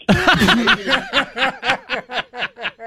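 high loud cackling laugh; big burst of laughter. Real, not acted. Telling the dinner party story, loud high laughter. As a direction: crack up hard at your own punchline.

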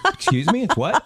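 high-pitched incredulous question; mock disbelief. Friend reacting to odd word danging, mock incredulous. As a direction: query it in laughing, exaggerated disbelief.

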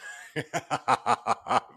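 amused chuckling burst; real laugh. Real, not acted. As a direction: break into laughter after the joke. Laughing at the punchline.

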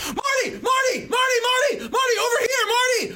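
put-on very high shrieking kid chant; kids shrieking for Marty. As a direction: shriek the name like excited kids. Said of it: "instead of make scary zombie noises, they're like".

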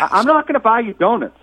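indignant. Real, not acted. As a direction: snap back, offended. Refusing angrily, voice raised.

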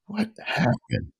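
slow, breathless whisper; a weak, dazed whisper after coming to. Retold or imitated. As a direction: barely get the words out, dazed. Waking up confused, couldn't talk.

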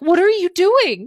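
high, slow, exasperated what-are-you-doing; mock disbelief at the husband. Exasperated mom quoting herself to husband.

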